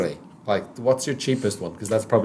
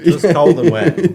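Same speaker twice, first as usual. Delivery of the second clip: ordinary talk, nothing marked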